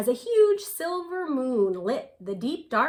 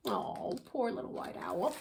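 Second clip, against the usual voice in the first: much softer, full of pity; pitying hush; 8 dB quieter than usual. A narrator's reading. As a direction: sigh it out with sympathy. Narrator pities owl.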